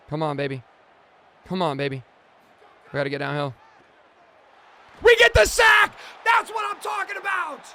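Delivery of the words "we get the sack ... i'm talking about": high, loud victory yelling; celebrating a big sack on stream; excited shout. Real, not acted. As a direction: scream it like your team just got the sack.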